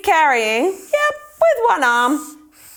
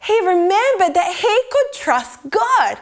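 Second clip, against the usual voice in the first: ordinary delivery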